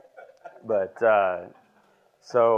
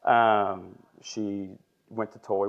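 ordinary talk, nothing marked

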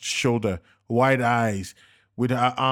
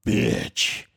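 hissed insult. A narrator's reading. Dying gunman curses Navina.

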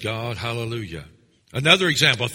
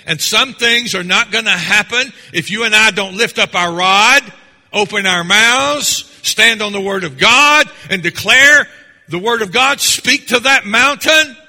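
loud, rising preacher's cry, slowing on "open our mouths"; full-force preaching; 5 semitones higher, 8 dB louder than usual. Acted. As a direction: drive the call home to the congregation.

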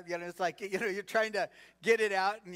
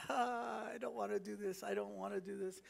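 soft mock whine, much softer; a believer whining softly to God about his task; 8 dB quieter than usual. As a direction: whimper it like a reluctant child. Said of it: "Anybody whine?".

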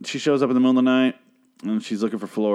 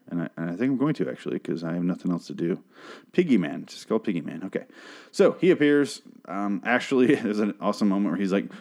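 ordinary delivery

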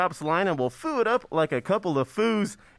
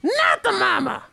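put-on high-pitched baby dinosaur voice; 13 semitones higher than usual. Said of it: "dinosaur impression".

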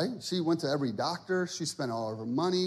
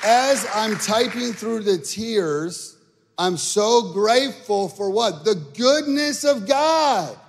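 high, loud, drawn-out testimony reading; preacher's rising, stretched cry of gratitude; impassioned preaching; 7 semitones higher, 5 dB louder, slower than usual. Retold or imitated. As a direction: build to a shouted praise and hold it high. Preaches the testimony at full force.

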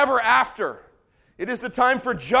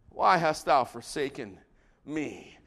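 low, soft aftermath of a scream; subdued, forsaken murmur; desolate hush; 9 semitones lower, 6 dB quieter, slower than usual. Acted. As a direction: let it sink to a quiet, low plea.

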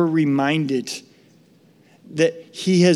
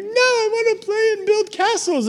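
put-on high whimpering kid voice; whiny crying child. Boy pleading to stay at the puddle, crying whine. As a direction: whimper like a toddler.

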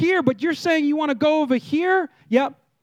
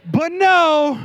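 higher, louder punchy "but no"; sassy no; 5 semitones higher, 5 dB louder than usual. Shown as a demonstration. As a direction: snap it with attitude. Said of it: "say it with some attitude".

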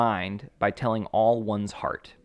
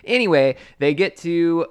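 usual speaking voice

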